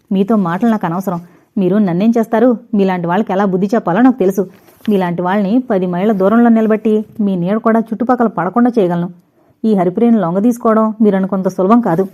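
usual speaking voice

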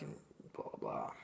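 tossed-off whisper; whispered blah blah. Real, not acted. Reviewer brushing off the boring dialogue, whispered. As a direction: breathe it away dismissively. Trailing dismissive summary.

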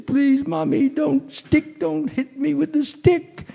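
put-on very high, pleading child voice; scared little child. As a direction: plead in a tiny falsetto like a frightened boy. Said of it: "he looks very scared".

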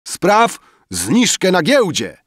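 high, loud, drawn-out demand; exclaiming. A narrator's reading. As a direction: shout the request slowly across the room. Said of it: "wykrzyknął bankier". (głośny, przeciągły, wysoki okrzyk)

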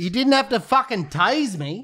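high, loud protest; acted prisoner shouting at police; angry yell. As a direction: shout it at the cop. Prank call, acted arrest.

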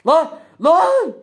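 sudden high loud cry of alarm; startled gamer shout; shocked yell. Real, not acted. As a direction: blurt it out in shock. Sudden shock during the game.

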